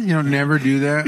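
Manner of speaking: normal talking voice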